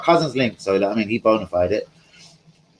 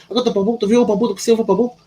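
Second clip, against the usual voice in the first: high-pitched Manchester dealer gabble; put-on Manchester patter, pitched right up; mock Manchester accent; 10 semitones higher, 3 dB louder than usual. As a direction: rattle it off as a caricature Mancunian dealer. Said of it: "he's proper Man-, Manchester voice".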